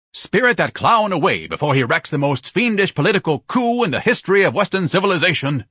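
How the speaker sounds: high, forceful outburst building in urgency; alarmed tirade. Acted. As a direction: declaim a pompous panic. Ignatius panics, orders sailor removed.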